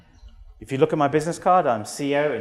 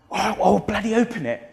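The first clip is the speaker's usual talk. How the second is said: impatient excitement; 8 semitones higher, 3 dB louder than usual. Retold or imitated. Urging wife over phone.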